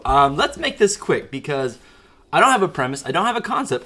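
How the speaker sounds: usual speaking voice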